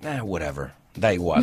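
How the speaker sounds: low, soft, bored brush-off; dismissive whatever. Retold or imitated. Host mimicking himself dismissing a friend, bored. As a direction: shrug it off like it doesn't matter. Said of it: "You're like".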